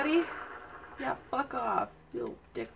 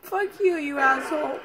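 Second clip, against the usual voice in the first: angry cursing; 3 semitones higher, 7 dB louder than usual. Real, not acted. Player cursing the creature they blame, loud and angry. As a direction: lash out at the enemy.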